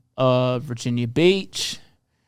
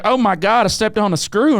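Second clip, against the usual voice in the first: put-on high, twangy Southern accent; 6 semitones higher than usual. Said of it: "you gave that screw a fucking Southern accent".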